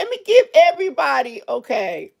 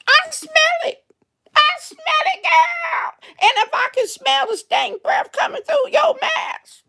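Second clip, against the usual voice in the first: indignant high outburst; 14 semitones higher than usual. Real, not acted. Cashier complaining to viewers about customers' bad breath, outraged. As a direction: exclaim in outrage. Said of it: "if you got stank breath".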